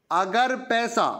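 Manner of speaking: ordinary delivery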